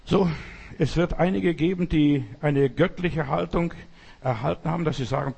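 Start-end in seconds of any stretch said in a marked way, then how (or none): none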